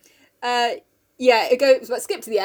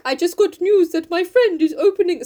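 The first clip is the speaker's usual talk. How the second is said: put-on accented voice, pitched up; Mister Bear; 5 semitones higher than usual. Bear telling Jo his news, in a put-on voice. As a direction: play the earnest foreign suitor. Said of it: "and he's like".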